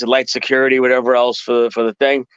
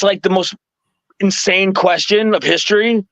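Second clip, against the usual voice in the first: normal talking voice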